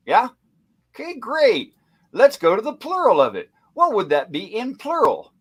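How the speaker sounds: high, emphatic mock teaching; sarcastic walk-through. Host rubbing the proof in to doubters, sarcastic.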